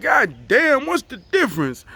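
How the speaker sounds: high burst on God damn, then falling away; exasperated outburst. Retold or imitated. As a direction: blurt it out in disbelief. Electricians say he needs electrician.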